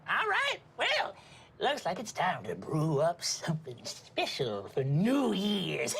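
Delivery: witchy grandma. Acted. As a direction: play a gleeful old witch, then cackle. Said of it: "you see the grandma says".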